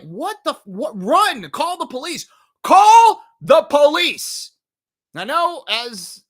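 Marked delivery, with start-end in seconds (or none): 0.0-2.2 high, urgent yelling at the screen; acted-out panic at dumb horror characters; exasperated viewer. Viewer urging the movie kids to flee, high exasperated alarm. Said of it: "you're like". 2.6-4.5 loud, high, drawn-out shout; exasperated shout. Acted. Viewer yelling at horror characters, drawn-out exasperated shout. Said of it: "you're like".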